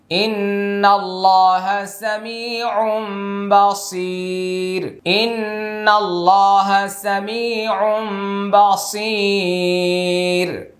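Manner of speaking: slow intoned সামিউম বাসির with a sustained ghunna; iqlab ghunna recitation. Shown as a demonstration. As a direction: chant সামিউম বাসির slowly, humming the m before the b. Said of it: "এটা ইকলাব গুন্না".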